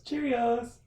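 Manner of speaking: Cheerios said high, mock British; British pronunciation. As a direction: sing out Cheerios like a Brit. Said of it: "Go get some Cheerios".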